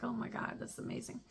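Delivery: very soft retold amazement; hushed, overwhelmed wonder; moved, near happy tears. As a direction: let it fall almost to a whisper, deeply touched. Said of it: "made me wanna cry".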